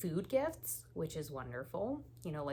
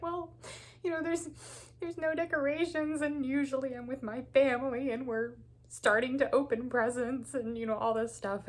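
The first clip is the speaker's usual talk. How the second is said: high, wailing, tearful complaint, much higher and louder; imitating herself sobbing to her husband, pitched way up; retold crying; 7 semitones higher, 4 dB louder than usual. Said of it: "when I was bawling my eyes out".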